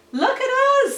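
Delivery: high, loud excited yelp; shrieking with glee; overexcited hound. Acted. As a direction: squeal it like an overexcited young dog. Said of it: "said an overexcited young hound".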